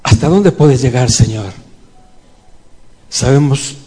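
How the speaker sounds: intimate church prayer; heartfelt prayer. Real, not acted. Prayer addressed to God.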